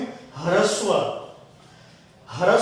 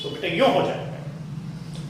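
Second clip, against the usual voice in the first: ordinary delivery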